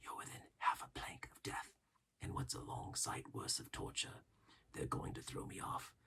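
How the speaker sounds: barely audible whisper; urgent secret whisper to Jim; steady whisper. A narrator's reading. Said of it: "in a steady whisper".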